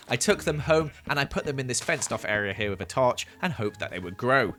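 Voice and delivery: ordinary delivery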